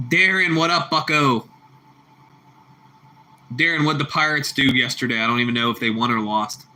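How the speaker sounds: ordinary delivery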